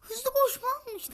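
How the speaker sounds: high-pitched, loud outraged cry; real annoyed outburst. Real, not acted. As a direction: yell in disbelief at suspected cheaters. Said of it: "daha sinir oldum". (sinirli, tiz sesle bağırma)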